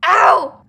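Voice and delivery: loud, high "ow"; pained yelp. Real, not acted. Player thinks they hurt themselves, pained yelp.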